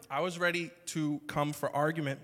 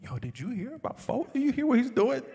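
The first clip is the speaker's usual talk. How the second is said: higher, louder, laughing gossip; gossipy excitement; 8 semitones higher, 6 dB louder than usual. Retold or imitated. Pastor imitating himself gossiping to Ro, excited and laughing. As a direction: spill the news gleefully with a laugh. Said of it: "I call up Ro".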